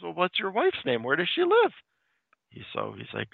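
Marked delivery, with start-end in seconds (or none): 0.0-1.7 a hint of a sly mayor, pitch climbing very high; eager questioning. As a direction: push the questions up and up. Mayor pressing for wife's address.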